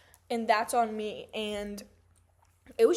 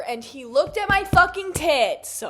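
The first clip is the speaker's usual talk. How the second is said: indignant; 7 semitones higher, 10 dB louder than usual. Real, not acted. Outraged by homeless man's stare.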